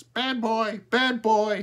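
high, loud squawk; high bird voice, loud; talking crow. A narrator's reading. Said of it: "he said".

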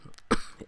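sharp cough from a sore throat. Real, not acted. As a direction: cough mid-sentence. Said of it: "yes, I'm still coughing".

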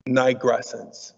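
"Nigrescence" said slowly and carefully, NIGH-gress-ence; word said slowly. Shown as a demonstration. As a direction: pronounce "Nigrescence" syllable by syllable for the class. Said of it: "Today's new word".

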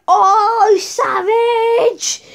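loud, high hyped-up yell; whooped reaction. Retold or imitated. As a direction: holler it like a hyped bystander. Acted loud reaction to insult.

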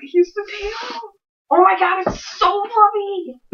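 high-pitched delighted squeal, drawn out on the last words; excited squeal. Real, not acted. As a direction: squeal with real delight at something fluffy. Unboxing fursuit, squealing at the tail.

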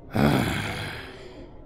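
weary sigh. Acted. Weary of the princess's questions.

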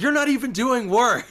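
high, loud imitation of mom, laughing; mock disapproving mother. Son quoting mom upset about his earnings, mock disapproving.

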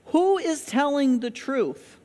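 normal talking voice

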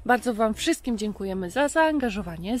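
ordinary talk

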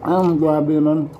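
juicy food in mouth; chewing while talking. Real, not acted. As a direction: mouth partly full of fruit. Seen 0: Holds bitten papaya; lips and jaw show chewing between bites throughout.

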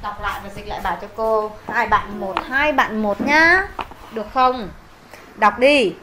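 ordinary talk

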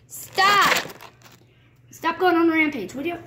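scolding the rabbit. Real, not acted.

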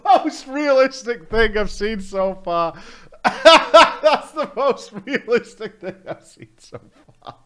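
slow, laughing through the words. Real, not acted. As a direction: crack up while saying it. Laughing at Reddit ban story.

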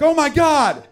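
high, loud gushing shock; mock amazement. Friend stunned by a great haircut, loud high gushing.

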